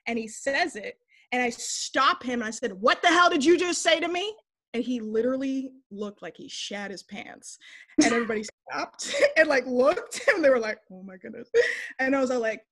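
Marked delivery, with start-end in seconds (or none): 2.8-4.3 higher, louder retold challenge; indignant confrontation. Woman stops coworker over nickname, indignant confrontation. 10.9-11.5 much softer, lower aside as stunned onlookers; hushed shock. Retold or imitated. Kitchen staff watching the confrontation, hushed shock.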